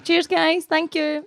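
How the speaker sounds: high, sing-out thank you to fans; cheery shout-out thanks. Pretend. Guest thanking fans who love her, cheery high thanks. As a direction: pitch it up for a friendly wave. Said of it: "give them a wee wave and say thanks".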